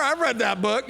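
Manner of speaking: high, loud sneering echo of I've read that book; mocking his own line. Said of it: "She was like".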